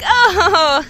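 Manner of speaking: high, loud startled oh; sudden flustered gasp of a word; caught-off-guard oh. Retold or imitated. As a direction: blurt it out, caught on the spot.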